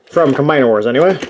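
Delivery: ordinary talk, nothing marked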